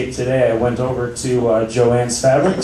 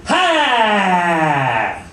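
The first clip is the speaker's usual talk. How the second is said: dismissive dad shout; 6 semitones higher than usual. Retold or imitated. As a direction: shoot the kid right down with one laugh. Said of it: "Here's an example".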